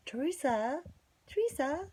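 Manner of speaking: high, sing-song call of a name; mock calling out. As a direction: call after someone in a high voice. Neighbor calling after her, retold.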